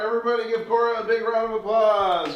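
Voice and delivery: loud announcer call to applaud; rally the crowd for applause; showman call. Real, not acted. Magician calls for applause.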